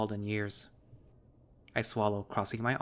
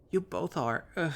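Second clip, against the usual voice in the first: snooty but dopey; 7 semitones higher than usual. Acted. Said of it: "with a snooty flair ... dopey, childish undertone".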